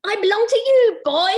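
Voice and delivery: shrill fairy voice, fully committed; Stink voice. Acted. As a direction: go full out on the fairy. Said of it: "I'm gonna change my stink voice".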